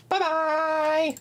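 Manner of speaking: high, drawn-out goodbye; sing-song sign-off. Pretend. Streamer ending the stream, high playful goodbye. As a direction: lift it high and stretch it out.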